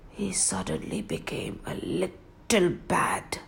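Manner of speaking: hushed whisper telling the change. A narrator's reading. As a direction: whisper it like a secret. King turns bad, hushed.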